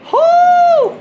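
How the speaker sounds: loud, high drawn-out "whoo"; loud nervous whoop. Real, not acted. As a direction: let out a long high hoot. Nervous whooping before a jump.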